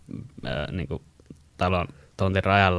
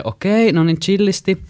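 higher, louder soothing calm-down; placating; 11 semitones higher, 6 dB louder, slower than usual. Retold or imitated. Speaker telling the yelling neighbour to chill, placating. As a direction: try to calm an angry neighbour. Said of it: "Sit mä olin vaan se".

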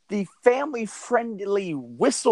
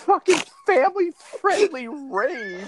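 incredulous; 11 semitones higher than usual. Real, not acted. Outraged disbelief at the phrase.

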